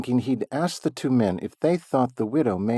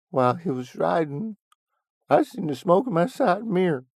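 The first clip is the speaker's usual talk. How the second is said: put-on high, fumbling old man voice; hesitant old Willie; 9 semitones higher, 5 dB louder than usual. A narrator's reading. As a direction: hem and haw like a flustered geezer. Willie stalling, explaining.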